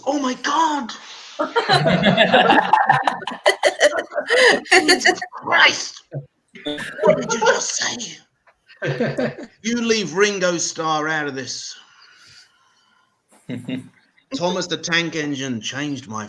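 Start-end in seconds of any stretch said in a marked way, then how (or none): 0.0-11.7 high, laughing fake shock; laughing mock outrage. Comic telling his crowd off, laughing mock outrage. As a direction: gasp through laughter, scandalised.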